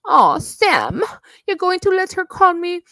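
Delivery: a mocking high whine; mocking whiny imitation. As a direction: mimic her whining to Sam. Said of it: "she makes me so fucking sick".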